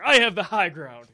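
mock yell. Acting out a movie brawl.

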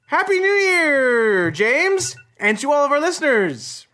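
high, slow festive greeting; drawn-out cheerful New Year wish; new year countdown crowd. Pretend. Midnight greeting after countdown.

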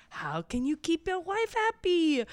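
high, loud showwoman voice; French madame. Retold or imitated. Madame selling to the husbands, high showy pitch. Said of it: "And then she's like".

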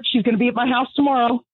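usual speaking voice

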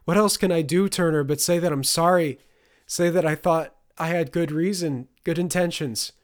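high, loud, desperate pleading; mother shouting her excuses at her son; almost shouting. Acted. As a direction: plead near a shout, not caring who hears. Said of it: "Sophia was almost shouting now".

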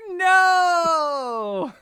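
high, loud crying no; retelling a film hero screaming at a sentence; mock anguished shout. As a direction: wail it like a dreamer in a nightmare.

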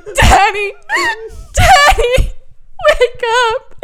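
high, loud wail, re-enacted; hammed-up hysterical crying plea; bawling. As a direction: wail his name as if sobbing in panic. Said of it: "And I'm bawling".

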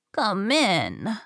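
storybook granny inviting someone in; lightly voiced old lady; grandma. A narrator's reading. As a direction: call them in as granny. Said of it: "said Grandma".

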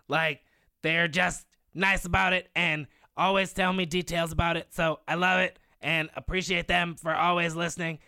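Cartman. Acted. Thanking two podcast listeners, in a Cartman impression. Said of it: "Can you please be Cartman".

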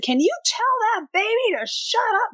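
imitating the woman who kicked the chair; mock rude woman. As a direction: sneer it like the annoyed passenger. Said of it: "she was like".

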